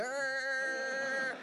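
high, long imitated honk; held-down horn. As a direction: hold a horn note till breath runs out. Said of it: "I haven't even got enough breath".

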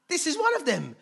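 high, loud mock accusation; accusing servant girl. Servant girl outing Peter to bystanders, high-pitched accusing cry. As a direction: point him out in a loud, high voice.

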